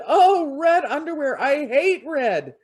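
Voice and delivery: high, loud, frightened monster shrieks; a beast recoiling in terror from red; the Nian screams in fear at red underwear; panicked screaming monster. Acted. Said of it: "the Nian started screaming".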